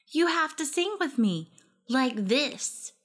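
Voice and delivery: put-on high, insistent child voice; a small girl giving orders; bossy little girl. A narrator's reading. Lily bossing, six-year-old.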